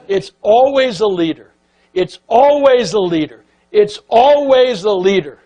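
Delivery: ALWAYS punched loud and high; emphatic refrain. Retold or imitated. Speaker driving the leadership point home, emphatic and loud.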